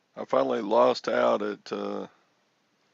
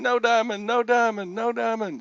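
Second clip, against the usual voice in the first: high, loud re-enacted yell; retold shout, pitched way up; yelling at screen; 11 semitones higher, 4 dB louder than usual. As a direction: chant it at the screen, begging the card away. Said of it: "I was just yelling at the screen".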